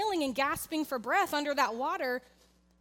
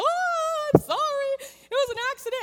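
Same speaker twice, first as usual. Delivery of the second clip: high, loud imitation of a frightened child; panicked little boy; 12 semitones higher, 6 dB louder than usual. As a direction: play the son apologizing in a panic. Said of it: "he's freaking out".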